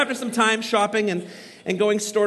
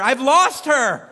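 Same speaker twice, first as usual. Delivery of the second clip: put-on slow, louder, desperate cry for a lost child; panicked yell; 4 semitones higher, 6 dB louder, slower than usual. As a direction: call out in panic, drawn out. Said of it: "I start yelling".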